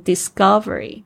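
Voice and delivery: discovery with a G-like sound after the S; C voiced as G. Shown as a demonstration. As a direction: say discovery as "dis-GUV-ery". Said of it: "所以它变成G的声音".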